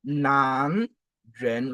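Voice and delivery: "nan" and "vren" each gliding upward; two rising syllables, "nan, vren"; second tone. Shown as a demonstration. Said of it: "c'est le deuxième ton".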